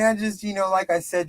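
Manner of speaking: ordinary talk, nothing marked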